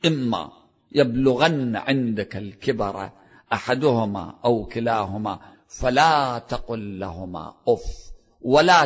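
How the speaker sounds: slow, measured verse reading; Quran recitation. Acted. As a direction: recite solemnly, stretching each phrase.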